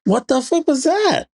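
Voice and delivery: high-pitched mock disbelief; mock surprise. Viewer reacting to secret agent twist, mock surprise. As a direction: act suddenly baffled by the twist.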